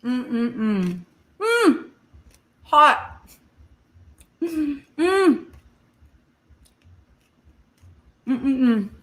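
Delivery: humming through a hot mouthful; pained hums with hot food in mouth; mouth full of hot food. Real, not acted. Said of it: "'cause I just burned my mouth".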